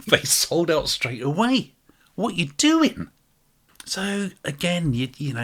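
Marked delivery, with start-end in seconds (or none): 2.1-3.1 high, loud What you doing; loud incredulous complaint; exasperated. Acted. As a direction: throw up your hands. Exasperated at label.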